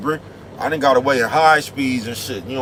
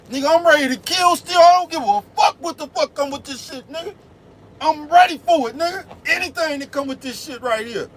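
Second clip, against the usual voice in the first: fired-up nephew yelling; 12 semitones higher than usual. Retold or imitated. Nephew defiantly yelling he's tired of being broke. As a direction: yell it like you're fed up with being broke.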